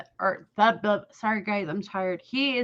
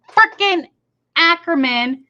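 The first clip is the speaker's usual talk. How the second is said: angry emphasis; 6 semitones higher, 7 dB louder than usual. Real, not acted. Angry aside about Danny Ackerman.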